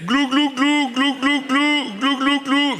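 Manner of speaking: high drawn-out gurgling; gobbling turkey voice. Pretend. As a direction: gurgle slowly like sinking under water. Drowning gurgle again.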